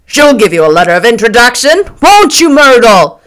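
loud, brash man putting someone on the spot; boisterous teasing shout; Tom, boisterous. Acted.

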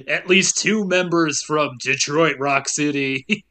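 slow announcer drawl; movie phone announcer. Acted. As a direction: keep the phone menu voice.

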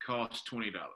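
ordinary delivery

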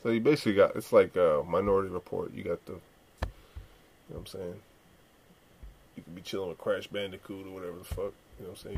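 ordinary talk, nothing marked

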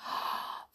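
quick frightened intake of breath; hushed startled gasp; sharp gasp. A narrator's reading. Fear in the dark.

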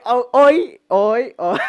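breaking into high laughter; can't get the word out for laughing; real laugh bursting through. Real, not acted. Reminded of something embarrassing.

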